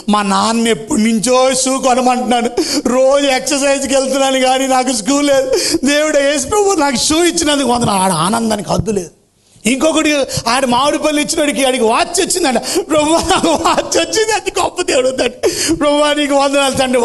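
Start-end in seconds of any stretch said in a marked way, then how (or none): 0.1-8.0 put-on child thrilled with new shoes, voice rising high; a boy overjoyed at his gift; happy boy. A narrator's reading. Boy thanks God for shoes, high and happy. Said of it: "ఆడికి నవ్వు, ఆనందానికి హద్దులు లేవు". 12.6-17.0 put-on happy child. A narrator's reading. Boy thanks God for watch, high and laughing. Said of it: "వాడికి happy అయిపోతున్నాడంట".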